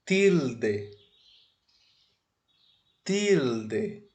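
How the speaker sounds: "tilde" with hard t, clear L and d; Spanish pronunciation. Shown as a demonstration. Teacher introduces the Spanish name, careful Spanish pronunciation. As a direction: say "tilde" as TEEL-deh, not "chiudji". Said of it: "Não é tilde".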